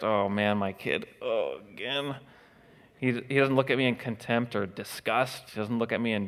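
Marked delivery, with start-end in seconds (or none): 0.0-2.2 soft, slower mock dismay; lower, disappointed groan of a parent; a hint of a let-down father; embarrassed parent. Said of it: "He's not embarrassed".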